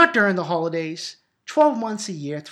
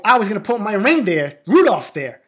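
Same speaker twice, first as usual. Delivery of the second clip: put-on loud, higher-pitched kid's protest; indignant protest; 5 semitones higher, 8 dB louder than usual. Brother claiming his tree spot from sister, indignant. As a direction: stake your claim loudly, in retold outrage.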